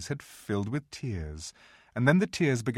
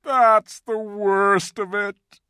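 loud, slow, high groan; drawn-out wailing groan; 11 semitones higher, 10 dB louder, much slower than usual. A narrator's reading. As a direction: groan it out in misery. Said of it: "said Mister Tumnus with a deep groan".

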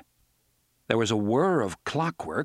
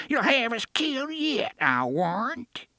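Mercy, cracked and quavering; 13 semitones higher than usual. A narrator's reading. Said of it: "she cried in a cracked, quavering voice".

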